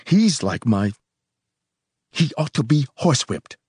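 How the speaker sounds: indignant disgust, voice rising; scornful, worked-up condemnation; distaste. A narrator's reading. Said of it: "he said with distaste".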